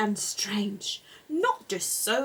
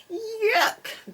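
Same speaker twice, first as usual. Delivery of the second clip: groaned; 12 semitones higher than usual. A narrator's reading. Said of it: "groaned Mister Rabbit".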